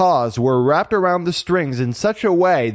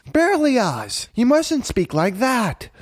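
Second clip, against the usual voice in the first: high-pitched little-sister reproach; scolding kitten sister; 10 semitones higher, slower than usual. A narrator's reading. Marie correcting her brother Berlioz, scolding. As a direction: chide him in a high kitten voice.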